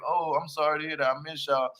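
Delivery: ordinary talk, nothing marked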